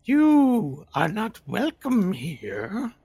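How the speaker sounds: high, slow voice from the darkness; dark whisper behind you. Acted. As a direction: stretch it out, cold and eerie. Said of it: "says the voice from the darkness".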